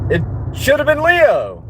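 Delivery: "Leo" thrown up high in disbelief; emphatic outburst. Real, not acted. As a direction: blurt it out high and protesting, landing hard on "Leo". Indignant at mascot name.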